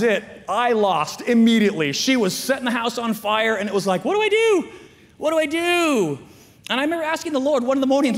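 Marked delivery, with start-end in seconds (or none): 4.0-6.2 high, loud desperate cry; hammed-up frantic plea; mock panic. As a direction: wail it helplessly, pitched way up. Retells his desperate panic as parent.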